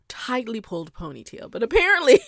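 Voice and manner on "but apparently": words breaking into a loud, high laugh. Real, not acted. Host laughs telling the ponytail gel story, loud laugh.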